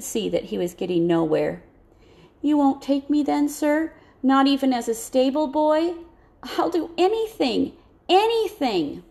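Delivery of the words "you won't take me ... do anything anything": much higher, desperate pleading; begging boy. A narrator's reading. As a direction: beg the officer for any job.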